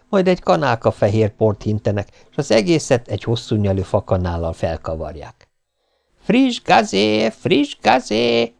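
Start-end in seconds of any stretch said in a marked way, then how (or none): 6.2-8.5 very high, slow singsong hawker's call; drawn-out street cry; vendor's cry. A narrator's reading. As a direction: stretch each word out high to draw customers. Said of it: "hangzott a kiáltás".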